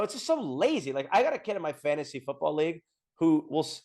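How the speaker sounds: ordinary delivery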